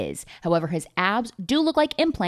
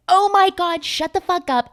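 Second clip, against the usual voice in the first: high, loud burst of sudden realization; excited outburst; 12 semitones higher, 7 dB louder than usual. Real, not acted. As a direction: cut yourself off with a high, loud exclamation. Suddenly remembers a forgotten detail.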